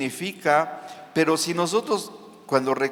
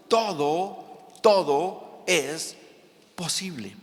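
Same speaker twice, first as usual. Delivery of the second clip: drawn-out promise; much slower than usual. Acted.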